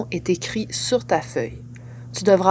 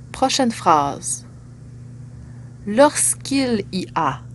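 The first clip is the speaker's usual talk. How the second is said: dictating; 4 dB quieter than usual. Real, not acted. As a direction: read each chunk with long pauses. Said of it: "Je vais lire chaque phrase partie par partie".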